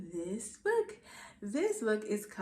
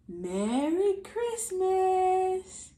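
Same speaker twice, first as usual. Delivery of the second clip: slow, drawn-out, louder greeting; jolly greeting; 5 semitones higher, 6 dB louder, much slower than usual. Acted. Santa wishing the children merry Christmas, slow and loud. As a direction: sing it out like Santa.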